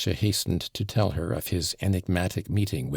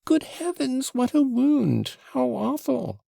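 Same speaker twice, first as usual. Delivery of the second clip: much higher, shocked cry at the sight of blood; shrieking in horror; 3 dB louder than usual. Acted. Mother sees Alyosha's bloody finger, horrified high shriek. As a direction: gasp it out, horrified by the wound. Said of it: "Madame Hohlakoff screamed".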